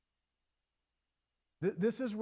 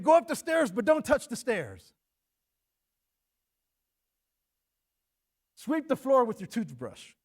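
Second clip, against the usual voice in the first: loud, high mock order barked at kids; unreasonable demand; 7 semitones higher, 8 dB louder than usual. Preacher mimicking an angry parent's impossible order. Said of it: "It's like saying".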